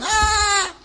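high squealing fan shriek, hammed up; shrill, excited fan squeal, mocked; mock fangirl scream. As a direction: imitate a crowd of screaming girls. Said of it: "girls all going".

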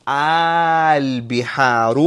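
البحار said slowly, the opening alif stretched long; long drawn alif. Shown as a demonstration. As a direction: draw out the first alif of البحار. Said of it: "We pronounce it as". (slow teaching demo of البحار with a long opening aa)

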